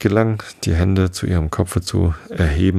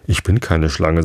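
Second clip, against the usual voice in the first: ordinary delivery, nothing marked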